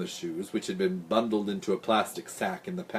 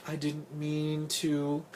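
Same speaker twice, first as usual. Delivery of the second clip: slow, high, soft and feeble; weak, apologetic little voice; weakly; 6 semitones higher, 8 dB quieter, much slower than usual. A narrator's reading. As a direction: answer feebly, as if drained. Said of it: "Big Dot said weakly".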